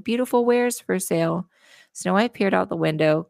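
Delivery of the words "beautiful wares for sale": peddler call. Acted. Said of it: "And she said".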